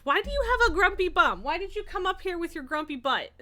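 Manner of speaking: high, loud mock scolding of the pet; playful teasing pet voice. Real, not acted. Owner playfully scolds her grumpy cat, high mock scolding.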